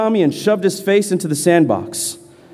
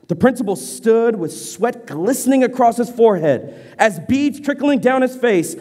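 ordinary delivery

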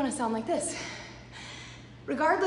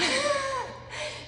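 brief laugh starting high; a light real laugh; soft laugh dropping away; short laugh; 11 semitones higher, 4 dB quieter than usual. Real, not acted. Laughs at her own description.

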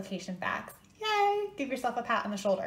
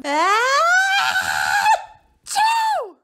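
huge exaggerated ah-choo; explosive cartoon sneeze; big sneeze; 11 dB louder than usual. Second sneeze, sorry, allergy season.

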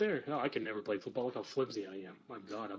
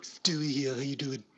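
louder hammed-up Stewie voice; Stewie impression; 4 dB louder than usual. Trying his Stewie impression on a stranger, lightly voiced. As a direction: greet them as Stewie, cartoonish. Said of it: "I'm gonna try Stewie impression".

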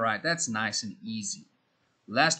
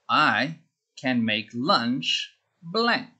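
slow dictation; slower than usual. Shown as a demonstration.

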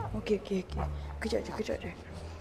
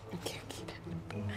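hushed okey okey; whispered soothing; 14 semitones lower, 15 dB quieter, slower than usual. Real, not acted. Mum whispers to settle the fussy baby. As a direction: whisper it softly to calm him.

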